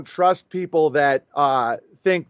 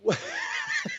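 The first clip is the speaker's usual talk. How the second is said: burst of laughter; 10 dB quieter than usual. Real, not acted. Reaction to host's question.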